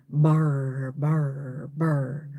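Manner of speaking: low mechanical burr noise; mock robot burring. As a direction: buzz like a machine shutting down.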